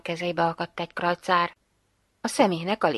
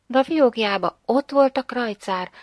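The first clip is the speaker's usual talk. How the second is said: ordinary delivery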